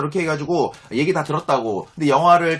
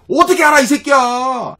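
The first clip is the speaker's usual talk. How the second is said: loud, higher angry comeback at a friend; angry retort; 6 semitones higher, 8 dB louder, slower than usual. Retold or imitated. Snapping at the friend who called him stupid, loud retold anger. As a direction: fire it back, offended and loud.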